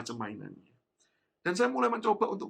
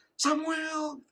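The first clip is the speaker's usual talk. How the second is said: calling a name; 14 semitones higher than usual. Retold or imitated. Mother calling lost son's name.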